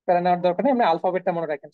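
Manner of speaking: usual speaking voice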